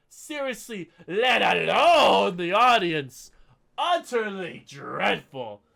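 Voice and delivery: theatrical critic reading with mounting outrage; mock dramatic review reading. Podcaster reading a viewer's harsh review, theatrical. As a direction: read the scathing review like a pompous critic.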